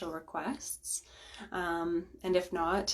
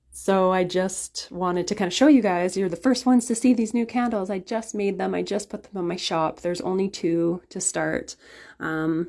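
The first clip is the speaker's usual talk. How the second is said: ordinary talk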